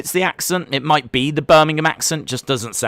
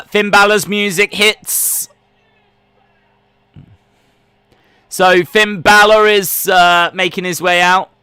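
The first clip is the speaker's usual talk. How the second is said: raised, higher live wrestling call; play-by-play commentary; 8 semitones higher, 6 dB louder than usual. Acted. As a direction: call the action like a ringside commentator. Calls the wrestling entrance and action.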